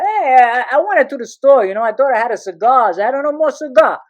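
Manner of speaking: hammed-up defensive father; excuse-making father. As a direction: imitate dad blustering through an alibi. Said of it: "he goes, uh".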